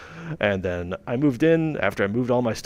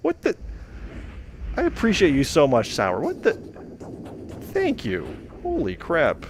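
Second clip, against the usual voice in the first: high, disbelieving yelps of shock; stunned surprise; 6 semitones higher than usual. Real, not acted. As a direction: gasp it out, can't believe it. Huge surprise donation reaction.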